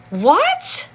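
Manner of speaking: high loud "what?"; surprised. Real, not acted. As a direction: caught off guard by news. Reacting to viewer's news.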